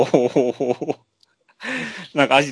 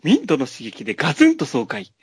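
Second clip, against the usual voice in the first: much higher, bright-sold pitch of a TV ad; cheesy commercial announcer; 5 semitones higher, 3 dB louder than usual. Acted. Podcast host voicing a fake ad, peppy announcer. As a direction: sell it like a late-night ad host. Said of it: "じゃあ、コマーシャルをどうぞ。".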